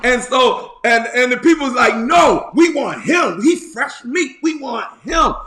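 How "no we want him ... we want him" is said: high, emphatic demand; rowdy crowd clamoring; insistent mob shout. Acted.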